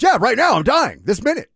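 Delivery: put-on high, loud old man voice; insistent grandpa. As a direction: grandpa matter-of-factly insisting. Grandpa voiced insisting he's dying.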